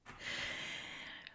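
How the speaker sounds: quiet, voiceless laughter; breathy whispered laugh; hushed giggle. Real, not acted. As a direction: giggle softly under your breath. Amused at viewer calling for cats.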